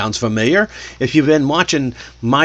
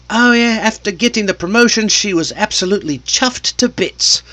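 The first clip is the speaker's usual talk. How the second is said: high-pitched put-on British accent; 8 semitones higher than usual. British person talking about her promotion, in a British accent. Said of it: "talking to a British person".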